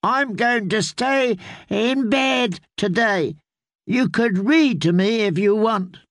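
put-on high, slow, whiny boy refusing; a spoiled child sulking in bed; petulant child. Boy lord voiced in story.

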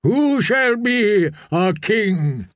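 high, slow questioning cry; drawn-out congregational appeal; crowd calling out. A narrator's reading. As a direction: call out as one of the faithful. Crowd calls for a king.

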